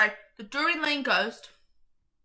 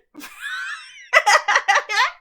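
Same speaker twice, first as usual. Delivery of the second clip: high laugh building to loud cackles; cackling laughter; 12 semitones higher, 9 dB louder than usual. Real, not acted. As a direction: burst out in high, staccato cackles. Cracks up after saying Onion head.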